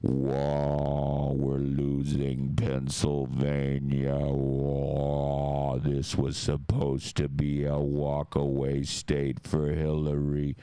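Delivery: mock anchor watching the map collapse; dazed caricature of a host losing it; imitating Cenk freaking out. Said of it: "He was doing that shit".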